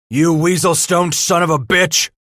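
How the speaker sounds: loud, high-pitched furious outburst; angry growl. A narrator's reading. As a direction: growl the insult at full anger. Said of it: "Cooper growled".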